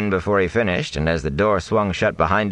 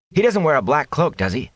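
put-on higher, anxious young voice; eager young man; 10 semitones higher than usual. A narrator's reading. Matt asking about the rider, higher and anxious. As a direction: ask it with a rising worry.

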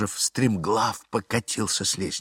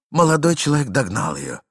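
ordinary talk, nothing marked

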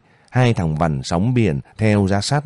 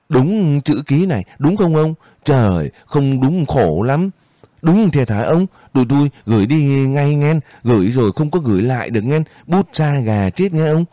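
higher-pitched, needling schemer; scheming henchman; 7 semitones higher than usual. A narrator's reading. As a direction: press him with mock concern, pitched up. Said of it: "cái tiếng thằng quân sư lại trồi lên".

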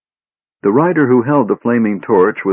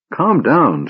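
firm, higher-pitched command; stern; 7 semitones higher than usual. A narrator's reading. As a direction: order it sternly. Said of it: "his voice stern".

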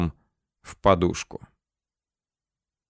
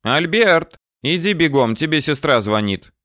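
put-on old woman calling out; 6 semitones higher than usual. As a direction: shout for him to hurry, old lady. Landlady calls the narrator.

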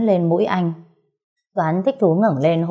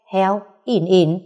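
slow mock pig grunt ỉn ỉn; pig grunt imitation; slower than usual. Playing animal sounds for his wife, a slow pig grunt. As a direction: drag out ỉn ỉn like a pig. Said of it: "nó kêu thế nào".

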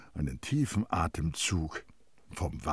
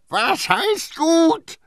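very high, loud, drawn-out roar; outraged, stretched-out yell; bellowing; 11 dB louder, much slower than usual. A narrator's reading. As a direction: bellow the question at her in fury. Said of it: "brüllte Terrier sie an".

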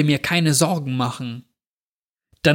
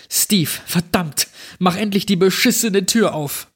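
voice getting louder; 6 semitones higher than usual. A narrator's reading. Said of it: "Meine Stimme wurde langsam lauter".

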